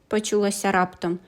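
ordinary delivery, nothing marked

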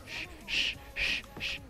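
four soft, short shushes; hushed shushing. Real, not acted. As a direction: shush softly while hiding. Shushing while hiding in game.

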